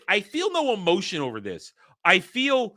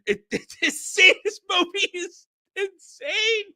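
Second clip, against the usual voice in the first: high-pitched, laughing through the words; squeaky, incredulous laughing speech; real helpless laughter; 14 semitones higher than usual. Real, not acted. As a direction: crack up while trying to talk. Reviewer cracks up at the absurdity.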